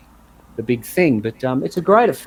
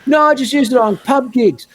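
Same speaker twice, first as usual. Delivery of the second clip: high mocking voice; 8 semitones higher than usual. Mocking purist's reply, far, +8.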